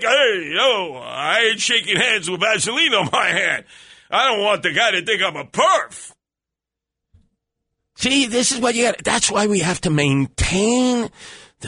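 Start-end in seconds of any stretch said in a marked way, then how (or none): 0.0-6.1 mock outburst. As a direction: yell it like you've been slimed. Recoiling from Vaseline handshake.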